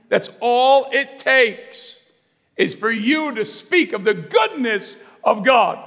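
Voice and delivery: loud, ringing sermon voice building to shouts; full-force preaching. Acted. Pastor rousing congregation to evangelize, full-force preaching.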